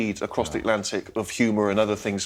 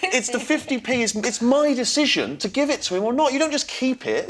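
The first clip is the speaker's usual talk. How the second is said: high, indignant protest; 11 semitones higher than usual. Real, not acted. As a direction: argue your case, outraged. Indignant rant about fifty p.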